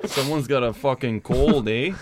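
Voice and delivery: Canadian reindeer making digs; put-on Canadian accent. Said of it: "very Canadian accent?".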